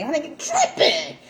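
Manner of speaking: laughing through the words, pitch jumping up; amused laugh at her own slip. Real, not acted. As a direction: giggle it out at yourself. Laughs at her own word mix-up.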